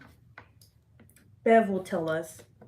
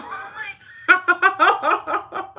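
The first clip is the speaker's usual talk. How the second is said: laughing hard; 12 semitones higher, 8 dB louder, much slower than usual. Real, not acted. Cracking up at the joke.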